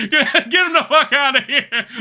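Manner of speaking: laughing mock dismissal; laughing yell. Real, not acted. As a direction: shout it while cracking up. Laughing at chat joke.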